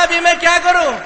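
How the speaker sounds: high, loud panic; mock panic over what to do; panicked question. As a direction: ask in desperate panic.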